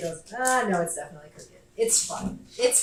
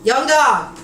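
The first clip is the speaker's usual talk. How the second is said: loud call across the house; 5 semitones higher, 12 dB louder than usual. Real, not acted. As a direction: shout for your roommate. Calling out to roommate.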